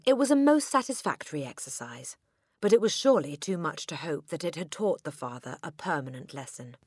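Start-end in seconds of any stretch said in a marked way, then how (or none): none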